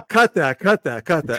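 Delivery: half-laughing plea to cut it; mock urgent cut call. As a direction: jokingly call for the edit, loudly. Mock order to edit it out.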